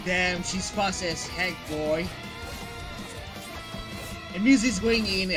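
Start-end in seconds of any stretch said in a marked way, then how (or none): none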